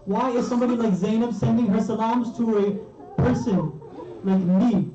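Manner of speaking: high, pleading lament; anguished disbelief. Retold or imitated. Habib asking why Zainab greets him, anguished. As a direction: cry out the question in humbled dismay. Retold Habib, distraught and humbled.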